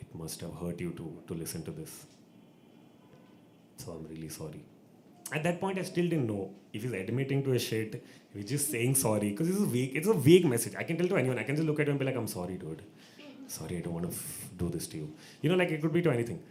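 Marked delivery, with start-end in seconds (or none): 0.1-4.6 low, soft imitation of a grave official; baritone apology. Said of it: "he gets his baritone voice out". 12.2-15.1 low, soft put-on sorry; mock apology. Mimics a vague apology.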